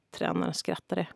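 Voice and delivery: plain reading voice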